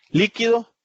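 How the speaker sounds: "líquido" hit high and loud on LÍ; stress on first syllable. Shown as a demonstration. Teacher showing how accent position changes meaning, "líquido" stressed on LÍ. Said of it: "por la posición del acento podemos distinguir".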